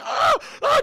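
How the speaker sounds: very high, loud cries; high screaming in pain. Acted. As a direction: cry out as the bear bites. Bear bites her arm.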